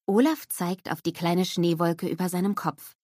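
ordinary delivery, nothing marked

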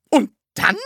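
high, loud, expectant question; eager suspense. A narrator's reading. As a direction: ask UND DANN on tenterhooks. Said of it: "fragte Frau Rotkohl gespannt".

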